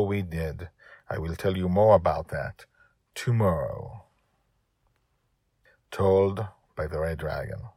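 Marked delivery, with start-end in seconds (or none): none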